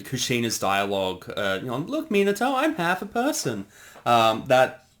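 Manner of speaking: usual speaking voice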